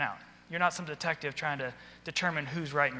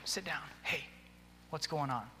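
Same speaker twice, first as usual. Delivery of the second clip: gentle check-in; 10 dB quieter than usual. Shown as a demonstration. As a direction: ease in quietly beside them. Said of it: "pull up a chair next to 'em".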